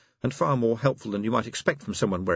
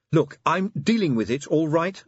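sharp, louder retort; snapped; 9 semitones higher, 4 dB louder than usual. A narrator's reading. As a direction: snap it back irritably. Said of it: "snapped Angua".

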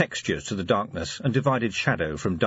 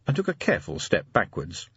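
ordinary delivery

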